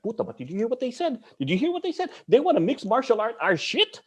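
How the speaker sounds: pumped and ready to go, imitated; retold self begging to fight, high excited voice; fired-up eagerness. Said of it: "I'm like".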